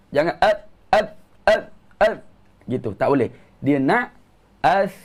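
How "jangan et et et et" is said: "et, et, et" clipped short with the voice held back, wrong way; mock the faulty held-back "et"; choked wrong sound. Shown as a demonstration. Teacher mimics the student fault of holding back the voice. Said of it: "jangan tahan suara".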